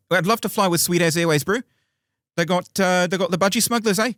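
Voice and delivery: put-on cheeky Kiwi accent, bro. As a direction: ham up a New Zealand bloke. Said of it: "Can you do a Kiwi accent?".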